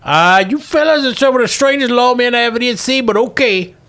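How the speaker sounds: put-on high, bemused old miner; old prospector. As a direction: marvel at the odd lawmen. [name]'s bemused remark.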